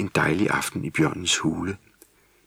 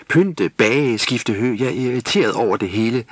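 grumpy, higher and louder complaint; sulky; 5 semitones higher, 4 dB louder than usual. A narrator's reading. Grumpy gnome grumbles to his mother. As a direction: spit out the chores in annoyance. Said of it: "sagde Vrisnis surt".

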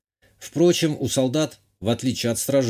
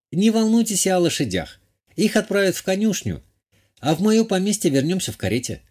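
high-voiced lady, reassuring then requesting; higher feminine character voice; assured countess; 6 semitones higher than usual. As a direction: play a gracious countess in a raised pitch.

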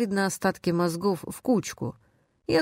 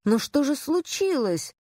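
high-pitched, lightly acted tearful plea; tearful cry; 8 semitones higher than usual. A narrator's reading. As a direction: cry it out high, with a touch of tears. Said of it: "вскричала она со слезами в голосе". (вскрикнуть жалобно, со слезами в голосе)

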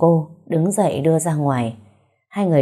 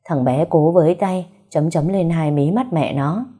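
ordinary delivery

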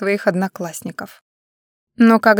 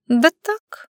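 evasive; 8 semitones higher than usual. Acted. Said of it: "уклончиво сказала Света".

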